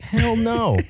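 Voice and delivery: very high, drawn-out refusal; hammed-up, high-pitched protest; mock indignant denial. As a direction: squeal out a comic denial. Acted indignant denial of mistaken identity.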